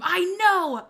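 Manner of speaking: high, loud burst of recognition; excited shout. Real, not acted. Streamer greeting a familiar name in chat, excited shout. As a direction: cry out in delighted recognition.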